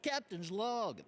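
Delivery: a light Kirk log entry; Captain Kirk impression. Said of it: "people tend to do that".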